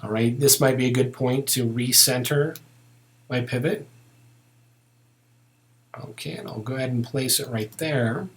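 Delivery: ordinary talk, nothing marked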